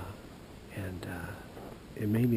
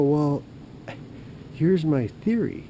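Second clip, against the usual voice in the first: higher, louder and slow; eager theory pitch; 6 semitones higher, 7 dB louder, slower than usual. Retold or imitated. Caller grandly presents his theory to official. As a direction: announce it with eager importance.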